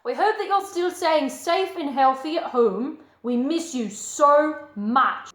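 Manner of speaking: loud, high-spirited channel welcome; exuberant host greeting. Acted. Host welcoming story viewers back, exuberant. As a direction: gush to the audience with big swoops.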